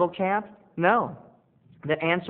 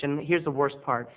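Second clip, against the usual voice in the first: ordinary talk, nothing marked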